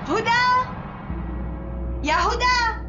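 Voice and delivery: high, loud call across the house. Acted. Mother-in-law calling Huda from afar, loud. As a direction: call Huda from another room.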